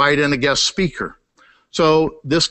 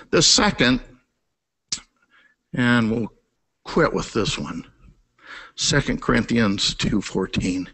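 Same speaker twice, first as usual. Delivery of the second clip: usual speaking voice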